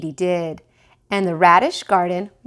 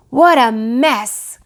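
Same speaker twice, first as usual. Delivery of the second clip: dismayed cry; 4 semitones higher, 8 dB louder, slower than usual. Acted. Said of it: "cried Daddy Hopkins".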